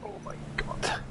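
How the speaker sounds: breathless shocked whisper; stunned whisper. Real, not acted. Exclaiming after a shock.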